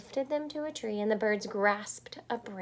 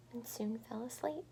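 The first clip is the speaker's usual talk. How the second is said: whispered narration; 13 dB quieter than usual. A narrator's reading. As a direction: breathe the words as she falls asleep.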